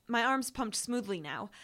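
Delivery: plain reading voice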